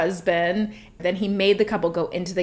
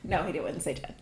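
ordinary talk

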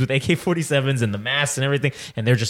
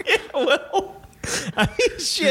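laughing; 13 semitones higher, slower than usual. Real, not acted. Laughing at cohost reaction.

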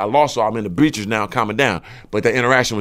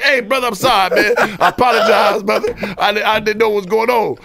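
nervous apology; 8 semitones higher than usual. Retold or imitated. Apologizing to man with knife.

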